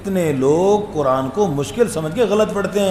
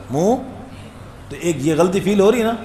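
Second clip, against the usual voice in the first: ordinary talk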